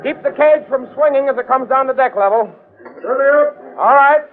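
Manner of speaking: high, carrying commands over the work; calling orders. Acted. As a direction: call it out to the men across the deck. Directing crew lowering gorilla cage.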